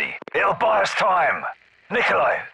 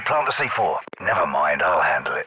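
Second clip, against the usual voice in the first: ordinary talk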